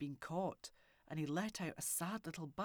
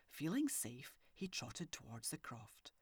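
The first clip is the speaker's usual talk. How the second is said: plain reading voice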